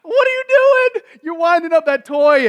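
high, loud laughing jeer; mocking laughter. Philistines laughing at David, high loud jeering. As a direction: cackle at the kid with the sling.

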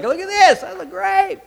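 high, loud show-off squeal; mock show-off. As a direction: crow it like someone desperate for admiration. Mock boast about showing off.

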